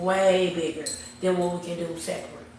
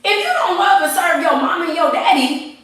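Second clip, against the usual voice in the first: preaching at full force, higher and much louder; impassioned rant; 8 semitones higher, 7 dB louder than usual. Real, not acted. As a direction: pound every line like a fired-up sermon. Heated rant to her viewers.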